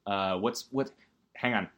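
ordinary talk

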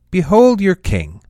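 high, ringing announcement; loud proclamation. A narrator's reading. Pilate presents Jesus to the crowd, loud proclamation.

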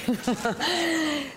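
high chuckle trailing the story; laugh. Real, not acted. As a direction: laugh at your own retelling. Laughs after retold exchange.

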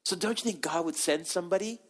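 ordinary talk, nothing marked

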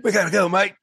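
high, hammed-up Australian accent. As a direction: put on a playful Australian accent. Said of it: "it's more Australian".

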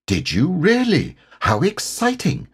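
put-on high-pitched, eager child character; bright-eyed excitement, pitched right up; excited. A narrator's reading. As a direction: react with keen, wide-eyed interest. Christopher responds to Eeyore's news.

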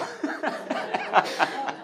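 long breathy chuckle; laughter. Real, not acted. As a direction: laugh along at your own joke. Laughs at own joke.

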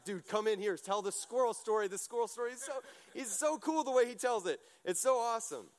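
hyped friend. Retold or imitated. Friends hype up the squirrel guy.

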